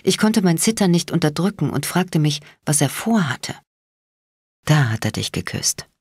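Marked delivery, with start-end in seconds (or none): 4.6-5.9 low, quietly growled menace. A narrator's reading. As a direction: growl it softly, deep down. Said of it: "Bones Stimme war ein leises Knurren".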